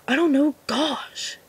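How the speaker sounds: slow, sulky mumbled Napoleon Dynamite whine; Napoleon impression. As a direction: lightly drag out a bored teen groan. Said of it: "not the best Napoleon impression".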